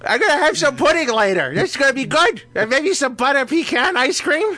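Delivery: put-on high, comic old-person voice; hospital old-timer. Hospital patient asking everyone about dessert, put-on old voice. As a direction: ask it like a hunched-over hospital patient. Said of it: "you just feel old".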